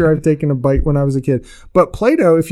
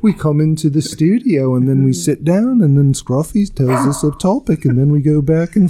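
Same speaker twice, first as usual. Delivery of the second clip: lilting mock-Liverpool accent. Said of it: "talk like [name]".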